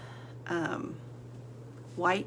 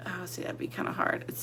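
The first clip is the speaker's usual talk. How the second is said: whisper; 6 dB quieter, faster than usual. Real, not acted.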